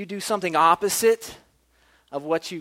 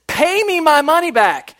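loud, high, drawn-out shout; choking demand; 12 semitones higher, 12 dB louder than usual. Acted. Servant chokeholds his debtor, shouted demand. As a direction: yell it slowly with full force. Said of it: "chokeholds this guy, and says".

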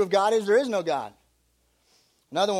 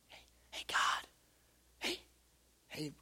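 whisper; 4 semitones lower, 15 dB quieter than usual. Shown as a demonstration. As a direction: tiptoe up and whisper to him. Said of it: "talk like this".

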